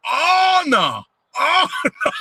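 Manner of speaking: very high, laughing squeal of disbelief; shocked coworker. Retold or imitated. Coworker at dumpster finding two men, squealing high with laughter. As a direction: yelp like you just saw something awful. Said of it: "I hear him saying".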